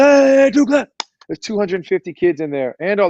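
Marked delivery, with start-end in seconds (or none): 0.0-0.9 high, loud command to the stadium; stadium clap call. Retold or imitated. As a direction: send the call echoing round the empty stadium. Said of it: "I was like".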